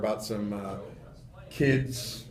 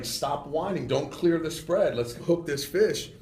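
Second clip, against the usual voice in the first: usual speaking voice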